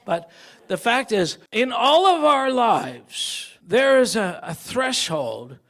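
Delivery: usual speaking voice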